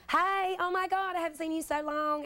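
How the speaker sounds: a thick Southern homecoming greeting; Southern accent, high and stretched. Shown as a demonstration. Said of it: "I'll start talking like this immediately".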